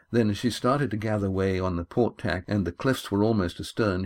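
plain reading voice

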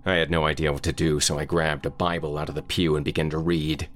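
usual speaking voice

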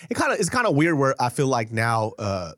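ordinary delivery

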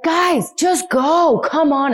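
high, loud, urging the cars on; mock impatience stuck in traffic; impatient driver. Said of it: "you're like".

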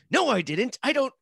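high-pitched, incredulous protest; indignant denial. Acted. Acting out denial of mishearing.

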